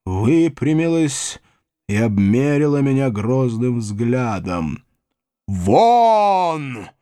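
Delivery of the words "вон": one long, high "out!"; old lady's imperious shout; commanding cry. A narrator's reading. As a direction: order out with a drawn-out cry. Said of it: "крикнула она".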